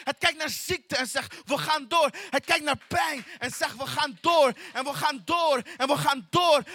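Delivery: rally the congregation, full force; rousing preaching. Acted. Preacher rallies the church through hardship, rousing.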